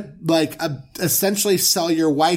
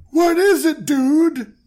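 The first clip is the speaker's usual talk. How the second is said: panicked plea; 6 semitones higher than usual. Pretend. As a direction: beg for help in a panic. Panicked Pharaoh pleading.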